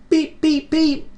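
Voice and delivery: very high beeping whistle; little engine whistle. Acted. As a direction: toot like a tiny engine. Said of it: "whistled Thomas".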